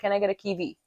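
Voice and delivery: "kiwi" said as KEE-vee; Indian pronunciation. Shown as a demonstration. Showing how one asks in India, V for W. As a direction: ask with a V in "kiwi". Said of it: "in India, if you wanna eat".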